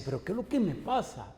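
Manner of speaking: high-pitched, perplexed self-questioning; troubled questioning. Retold or imitated. Preacher recalling his struggle beside his friend, troubled questioning. Retelling his own spiritual frustration.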